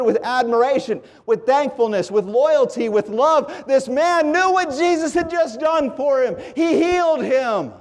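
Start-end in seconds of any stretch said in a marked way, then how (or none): 3.7-7.7 higher-pitched, driving sermon cadence; impassioned preaching. Real, not acted. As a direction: build each repeated phrase like a preacher. Preacher at a high point.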